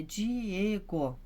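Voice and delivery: "Diego" very slowly stretched, di-EEE-go; stress on E. Shown as a demonstration. Teacher sounding out a pupil's name, slow "Diego" stressed on E. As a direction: draw out every syllable of "Diego", leaning on E. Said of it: "então o E que é o forte".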